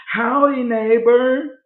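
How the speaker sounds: high, loud sing-out hello; mock cheery greeting. Stalking neighbor greets him at the door, mock cheery. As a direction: imitate an overeager neighbor calling hello.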